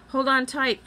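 high, loud warning; urgent cry. A narrator's reading. Boy warning his sister at takeoff, crying out. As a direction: cry out to your partner as you take off. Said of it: "cried Jack".

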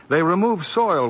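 ordinary delivery

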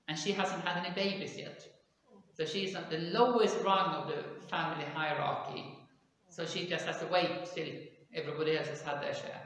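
ordinary talk

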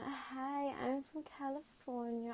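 softly spoken Californian accent demo; mock-sultry American accent, soft. Shown as a demonstration. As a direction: do a hushed Californian "hi". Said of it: "I just sound horny".

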